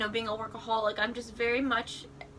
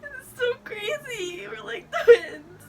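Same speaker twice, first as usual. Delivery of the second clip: crying; 10 semitones higher, 7 dB louder than usual. Real, not acted. Crying after the chocolate shop story.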